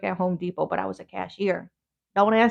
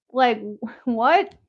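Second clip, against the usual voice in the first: exasperated; 4 semitones higher, 9 dB louder than usual. Real, not acted. Annoyed at the clerk.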